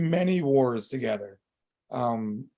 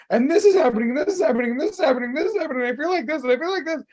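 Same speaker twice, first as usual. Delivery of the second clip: high-pitched, louder, tumbling list of worries; frantic venting; 8 semitones higher, 4 dB louder, much faster than usual. Retold or imitated. To his son and wife, spilling every worry in a high frantic rush. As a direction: pour it all out in a rush, voice up high. Retelling himself venting through tears.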